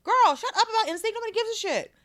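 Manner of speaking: high, loud, fed-up telling-off; mock yell. Teen shouting down a classmate, mock-stern, high and loud. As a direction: shout her down like a scolding elder. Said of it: "I was like".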